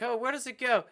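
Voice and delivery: high-pitched panicky question; mock freaking out. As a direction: ask it like a father in comic panic. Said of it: "freaking out".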